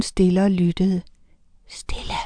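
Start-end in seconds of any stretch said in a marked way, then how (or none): 1.7-2.1 hushed, urgent whisper; very soft, breath-only hush. A narrator's reading. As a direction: whisper it as a warning. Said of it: "hviskede han".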